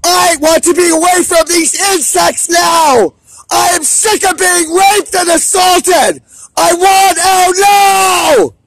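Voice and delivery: furious shouting. Real, not acted. Man shouting at campus staff he feels abandoned by, furious yelling. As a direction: scream your anger at the idiots ignoring you.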